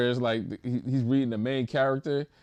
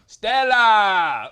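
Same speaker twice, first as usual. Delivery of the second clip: louder hammed-up Spanish-accented call; Spanish accent shout; 8 semitones higher, 9 dB louder than usual. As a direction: cry out Stella in a Spanish accent. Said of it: "he was just".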